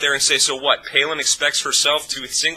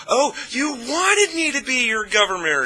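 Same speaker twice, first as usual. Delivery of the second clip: high-pitched mocking gush of fake delight; exasperatedly happy; 11 semitones higher than usual. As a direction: put on an exasperated, overjoyed politician's voice. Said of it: "in some exasperatedly happy voice".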